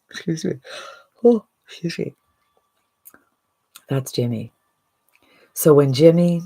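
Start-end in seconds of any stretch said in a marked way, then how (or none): 0.1-0.5 a quick, soft excuse me through a laugh; breaking up into a light laugh; laughing apology. Real, not acted. Laughing apology after Jimmy impression. 1.2-2.1 a much higher, louder Oh, excuse me; flustered, squealing apology; high exclamation. Real, not acted. Second apology after Jimmy impression.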